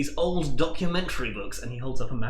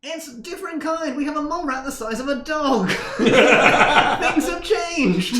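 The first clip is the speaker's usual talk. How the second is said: high, giggly flustered nerd; laughing nerd; 13 semitones higher, 4 dB louder, slower than usual. Acted. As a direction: laugh it off awkwardly.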